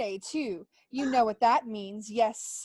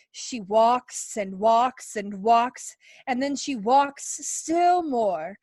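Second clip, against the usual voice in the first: slow, louder, pressing each walks; emphatic; 6 dB louder, slower than usual. Acted. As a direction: hammer home how much she walks. Snake stresses wife's walking.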